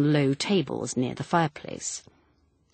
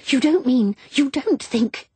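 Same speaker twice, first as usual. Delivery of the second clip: high, sharp, alarmed challenge; sharp interjection; 10 semitones higher, 6 dB louder than usual. A narrator's reading. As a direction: cut in sharply on a high pitch. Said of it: "Elaine said sharply".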